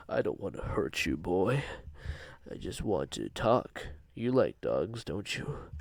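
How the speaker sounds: put-on a creepy wolf sweet-talking the boy; coaxing monster. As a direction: lure the boy down sweetly but menacingly. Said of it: "he would try to talk to me".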